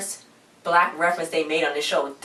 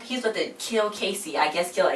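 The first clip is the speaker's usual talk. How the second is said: normal talking voice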